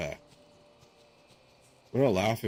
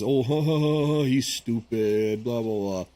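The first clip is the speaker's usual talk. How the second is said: mocking laughter; 2 semitones higher than usual. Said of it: "It's not because".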